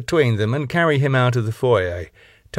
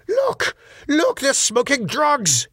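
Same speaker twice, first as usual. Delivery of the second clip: high, panicky yelps, slowing on the last words; frantic alarm; slower than usual. A narrator's reading. Owner frantically points at lads outside, panicked high cries. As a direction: point and shriek in pompous alarm. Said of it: "pointing frantically".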